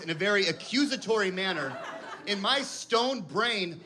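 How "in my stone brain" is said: ordinary delivery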